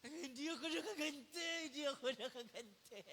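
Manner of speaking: whiny mock sob, lightly done; mock sobbing. Answering his sister while crying, a light mock sob. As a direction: whimper it like a hurt kid. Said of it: "iyak nang iyak".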